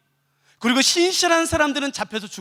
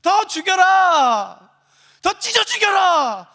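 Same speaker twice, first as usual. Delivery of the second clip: high, drawn-out mob yell; gleeful bloodthirsty shout; 6 semitones higher, 5 dB louder, much slower than usual. Acted. Apostate forced to cheer the killing, shouting. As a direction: howl it out slowly for the kill. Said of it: "기뻐하면서 웃으면서 소리 질러야 돼요".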